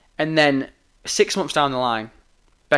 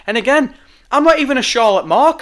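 usual speaking voice

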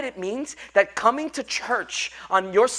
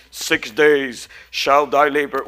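lower, slow, booming Moses voice; Moses movie voice; 10 semitones lower, 5 dB louder, much slower than usual. Retold or imitated. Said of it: "sounds like Moses".

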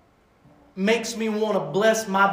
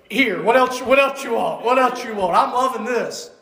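louder, eager and delighted; eager giving; 3 semitones higher, 5 dB louder than usual. Retold or imitated. Father eagerly offering his kids more, excited and louder. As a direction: gush like a dad emptying his wallet. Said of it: "and go".

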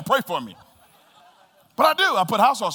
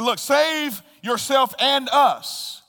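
slow, raised, scornful demand; rejecting taunt; 7 semitones higher, 4 dB louder, much slower than usual. Acted. Thief rejecting Jesus, slow loud taunt. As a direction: throw it at him with scorn. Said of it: "The thief said in rejection".

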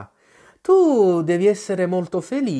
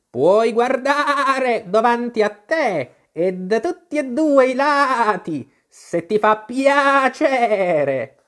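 much higher, slow bleating sheep voice; old sheep shopkeeper; 8 semitones higher, 4 dB louder, slower than usual. A narrator's reading. Old sheep shopkeeper asks Alice, slow bleating voice. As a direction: ask like an old knitting ewe behind the counter.

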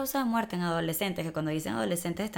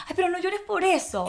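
high-pitched parental reproach; exasperated adult voice, acted; mock scolding; 9 semitones higher than usual. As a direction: chide a crying child, pitched up. Acting adults scolding a crying child.

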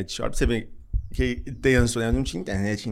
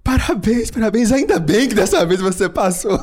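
laughing mock congratulations; 9 semitones higher, 7 dB louder than usual. Colleague's mistaken congratulations.

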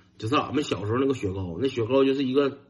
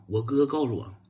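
ordinary talk, nothing marked

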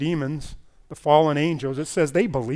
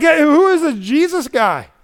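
higher, mock scoffing; dismissive crowd voice; 8 semitones higher, 7 dB louder than usual. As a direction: brush him off like a doubting neighbour. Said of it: "They were minimizing him".

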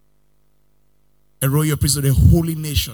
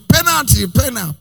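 high, louder chant of penalty; mock penalty appeal; 8 semitones higher, 4 dB louder than usual. Preacher imitating fans appealing to the ref, loud chant. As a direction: call for the penalty like a partisan fan.

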